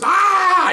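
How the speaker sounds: very high, loud mock pain scream; parallel scream. Officer and suspect both hurt, screaming side by side. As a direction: act out two men screaming in pain. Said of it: "him and I are both parallel screamers".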